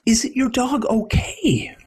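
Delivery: high, half-whispered, laughing worried question; lightly voiced young man, amused and concerned; young neighbor voice. Young man asks the old man about the crying dog, hushed and amused.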